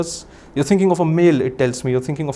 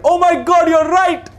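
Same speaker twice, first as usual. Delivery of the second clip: much higher, louder gasp of delighted amazement; mock amazed; 12 semitones higher, 4 dB louder, slower than usual. Shown as a demonstration. Mentalist showing the volunteer how to react. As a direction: demonstrate an over-the-top amazed reaction. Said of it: "just the way I did it".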